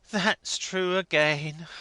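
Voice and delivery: slow, drawn-out weary agreement; haggard perplexity. A narrator's reading. Clergyman conceding, slow and perplexed. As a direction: sigh the agreement out slowly. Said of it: "becoming haggard with perplexity".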